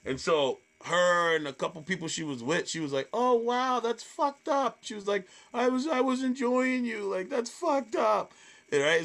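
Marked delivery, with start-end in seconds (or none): 3.1-4.8 high imitation of a hurt audience member; offended woman. 5.5-8.3 high, hurt protest, slowing at the end; offended woman. Retold or imitated.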